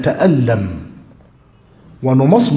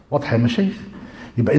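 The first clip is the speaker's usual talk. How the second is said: ordinary delivery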